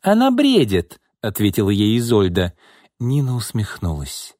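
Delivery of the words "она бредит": very high, sharp retort; indignant dismissal. A narrator's reading. Boss dismisses secretary's charge to reporter, indignant. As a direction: wave it away, voice pitched high.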